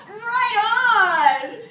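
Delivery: loud, high, drawn-out whoop; excited cheer. Acted. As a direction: cheer like you just hit the jackpot. Cheering own vending machine win.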